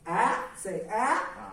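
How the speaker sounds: "ah" with the pitch sliding up; rising tone "ah". Shown as a demonstration. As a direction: lift the pitch through "ah". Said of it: "with the rising tone".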